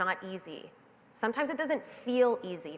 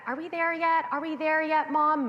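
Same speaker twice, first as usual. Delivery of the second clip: put-on high, impatient little-kid nagging; whiny kid in the car; 7 semitones higher, 3 dB louder than usual. Kid nagging mom from the back seat, high and whiny. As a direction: nag like a bored six-year-old in the back seat. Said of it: "I remember it was like that".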